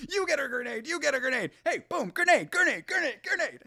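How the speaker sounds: high, excited mock grenade calls; grenade warning yell. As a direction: gleefully toss out grenades. Mock tossing grenades everywhere.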